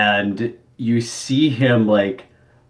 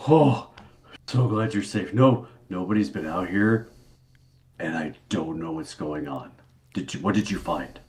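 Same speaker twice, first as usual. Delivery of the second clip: npc voice; 6 semitones lower, 7 dB quieter than usual. Acted.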